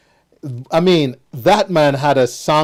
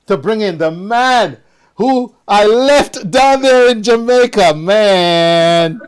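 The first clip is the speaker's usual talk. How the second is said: hyped-up intro; 5 semitones higher than usual. Real, not acted. Host hypes incoming guest.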